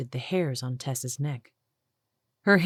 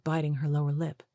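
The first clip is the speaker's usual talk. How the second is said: ordinary delivery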